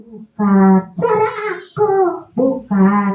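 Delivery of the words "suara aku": high puppet insistence; mock angry puppet. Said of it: "Jangan marah dong".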